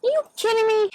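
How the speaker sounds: high, loud incredulous outburst; retold outrage. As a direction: throw it out in disbelief. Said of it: "And I'm like".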